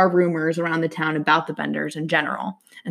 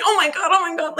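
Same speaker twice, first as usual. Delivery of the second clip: mock panic; 11 semitones higher than usual. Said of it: "she's, like, running".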